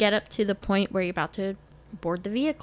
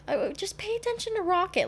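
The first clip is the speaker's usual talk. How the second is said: much higher, cheerful coaxing voice; high excited coaxing; 12 semitones higher than usual. Retold or imitated. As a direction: coax brightly over the noise. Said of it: "they were like".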